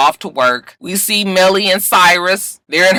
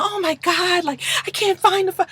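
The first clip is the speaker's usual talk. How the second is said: put-on high, airy girly-girl voice, much higher; 14 semitones higher, 5 dB quieter than usual. [name] on a dating show, gushing in a girly voice. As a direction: gush like a starry-eyed reality show contestant. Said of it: "she never was girly and just like".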